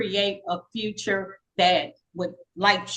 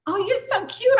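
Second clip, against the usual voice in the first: put-on girl's compliment; 12 semitones higher than usual. New girl compliments her.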